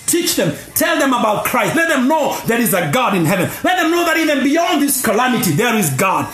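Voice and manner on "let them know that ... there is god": usual speaking voice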